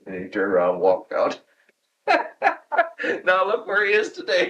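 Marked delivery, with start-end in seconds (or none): 2.1-3.2 a high, helpless burst of laughter; real high laugh. Real, not acted. Old engineer remembering the boss's promotion, laughing high. 3.2-4.5 much higher, talking through laughter; laughing. Real, not acted. Retiree marvelling at his old boss's career, laughing.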